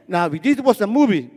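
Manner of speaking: ordinary talk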